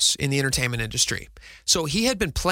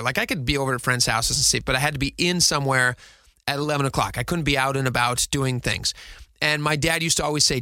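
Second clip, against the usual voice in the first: ordinary talk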